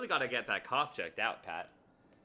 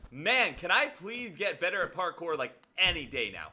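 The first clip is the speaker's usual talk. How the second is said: exasperated plea; 7 semitones higher, 8 dB louder than usual. Real, not acted. Streamer stuck on the parkour section, exasperated plea. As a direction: beg the game to let you win, fed up.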